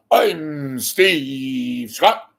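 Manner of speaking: booming, drawn-out announcer sign-on; Steve Scott. Retold or imitated. Host mimics a newscaster's introduction, pompous drawn-out boom. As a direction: stretch the name out slow and loud, hammed-up. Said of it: "put off the".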